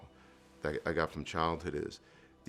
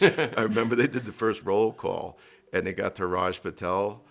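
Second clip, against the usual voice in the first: louder, laughing through the story; laughing while telling; 2 semitones higher, 8 dB louder than usual. Real, not acted. Telling host about the Raj Patel roll call, laughing. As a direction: chuckle through the memory.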